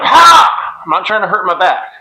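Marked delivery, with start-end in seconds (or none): 0.0-0.8 loud high sudden "Ha!"; sharp jerky grunt. Retold or imitated. Coach showing the wrong jerking move, a loud mock "Ha!". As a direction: bark out a jerky effort grunt. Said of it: "I'm not going".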